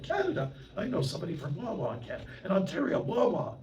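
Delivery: high, excited chatter about Wawa; excited dealer. Retold or imitated. Dealer telling the monk about his friend from Wawa, excited and high. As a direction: get high and excited about a town name.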